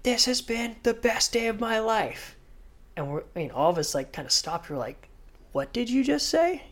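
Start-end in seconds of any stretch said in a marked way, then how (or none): 0.0-2.4 lightly voiced slow, higher toast. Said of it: "Kelly got up and did a cheers". 5.5-6.6 much higher stunned question; disbelief. Retold or imitated. Stunned by Kelly's toast.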